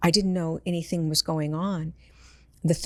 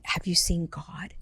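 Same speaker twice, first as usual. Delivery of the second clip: whispered question. Retold or imitated. Medium asking her dead brother about God, whispered. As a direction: ask in an awed hush. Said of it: "I said".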